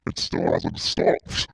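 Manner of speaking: very high, slow robotic voice. Acted. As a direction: drag it out like a broken robot. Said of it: "I sound like a robot".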